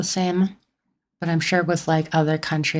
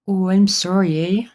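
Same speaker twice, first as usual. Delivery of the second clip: put-on playful Nova Scotia accent, louder; mock Nova Scotia accent; 3 semitones higher, 5 dB louder than usual. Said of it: "put on a Nova Scotia accent".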